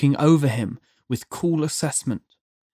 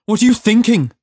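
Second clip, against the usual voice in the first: high, loud, heated question; sharp demand; 7 semitones higher, 6 dB louder, faster than usual. A narrator's reading. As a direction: demand an answer loudly. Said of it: "Judd demanded".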